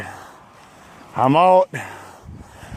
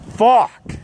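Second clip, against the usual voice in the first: frustrated curse; 5 semitones higher, 3 dB louder than usual. Real, not acted. Angry cyclist cursing the hills.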